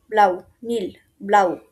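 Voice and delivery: ব্লাউ repeated, open au glide; German blau. Shown as a demonstration. Teacher drilling ব্লাউ as German blau. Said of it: "উচ্চারণটা করতে হবে ব্লাউ".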